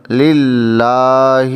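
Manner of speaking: للہ with the la held long, very slow; elongated recitation. Shown as a demonstration. Teacher showing the long vowel in للہ, very slowly. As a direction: draw out للہ, holding the long a. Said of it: "للہ نہیں کہنا".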